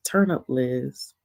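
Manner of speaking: hushed whisper. Real, not acted. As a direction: whisper it playfully. Hypes up a friend.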